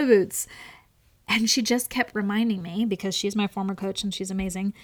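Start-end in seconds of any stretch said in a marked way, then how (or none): none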